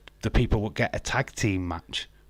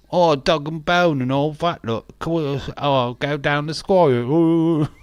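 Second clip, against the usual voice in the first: loud, raised cartoon Cockney; mock London accent; 6 semitones higher, 6 dB louder than usual. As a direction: pile on the London slang in a hammed-up accent. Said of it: "I'm not a Londoner".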